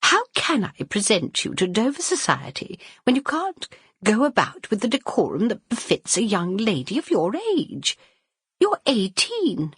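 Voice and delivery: mother reprimanding Violet over her behaviour; stern mother. Acted. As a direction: lecture her on decorum.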